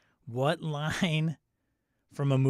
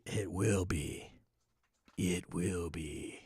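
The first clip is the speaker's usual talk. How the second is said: low, slow, hushed foreboding; quiet, drawn-out warning; mock ominous; 6 semitones lower, 6 dB quieter, much slower than usual. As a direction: draw it out softly like a dark prophecy. Ominous repeated weather warning.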